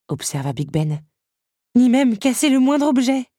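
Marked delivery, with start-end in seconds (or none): 1.7-3.3 high, loud disbelief; choking with astonishment. A narrator's reading. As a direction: gasp the words out as the teapot. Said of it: "s'étouffa la théière". (stupéfaction d'une théière qui s'étouffe)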